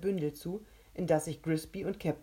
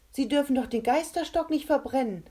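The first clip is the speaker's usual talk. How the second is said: alarmed protest; 8 semitones higher, 5 dB louder than usual. Acted.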